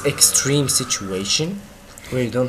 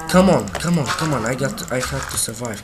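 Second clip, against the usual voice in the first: usual speaking voice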